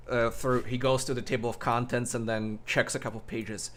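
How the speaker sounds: usual speaking voice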